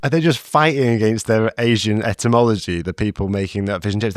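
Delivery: ordinary talk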